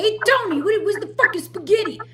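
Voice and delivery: loud, high-pitched caricature of an Italian-American; hammed-up Italian-American shout; mock Italian-American. Host mimics guys that Italians dislike, mock Italian-American. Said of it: "they don't like the guys that say".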